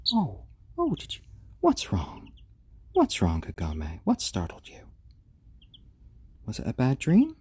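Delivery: high alarmed oh, then worried questions; 1 startled concern. Real, not acted. Owner startled as her chick jolts awake, concerned.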